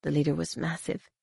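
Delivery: ordinary delivery, nothing marked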